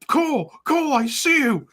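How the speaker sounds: high, sing-song calling out; mock Vince calling. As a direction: taunt him from across the room. Vince spotting Cole, retold.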